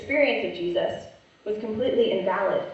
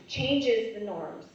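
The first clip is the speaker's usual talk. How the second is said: usual speaking voice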